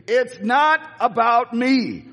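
slow, high, drawn-out mock yell; retold yelling. Preacher re-enacting yelling at the screen with Gene, slow mock yell. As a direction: call it out slowly, as if at a screen. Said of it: "Gene and I are yelling it together".

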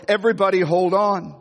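ordinary talk

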